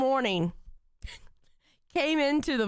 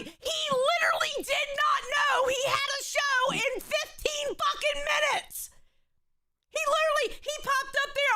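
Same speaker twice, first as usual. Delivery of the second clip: incredulous shout; 10 semitones higher than usual. Real, not acted. To the co-host, a high incredulous shout. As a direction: shout it, can't believe it.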